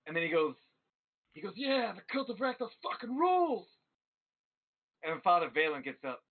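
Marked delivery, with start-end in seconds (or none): 1.6-3.7 high whooping fan cheer; hammed-up rowdy fan yell. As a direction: cheer it high, stretching the last word. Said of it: "he starts yelling".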